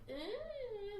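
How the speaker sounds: high drawn-out whine; whiny noise. Shown as a demonstration. Showing the contrast with grunts, high whiny moan. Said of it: "whiny, and it's, like, it's a little bit higher".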